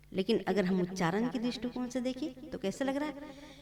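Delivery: ordinary talk, nothing marked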